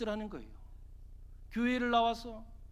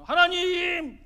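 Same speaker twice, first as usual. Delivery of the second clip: a big, drawn-out cry to heaven, acted out; loud prayer cry; 7 semitones higher, 9 dB louder, much slower than usual. Imagined healer calling on God over the patient, loud prayer cry. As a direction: call out high and loud, dragging the syllables. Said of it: "하나님의 이름을 크게 부르면서".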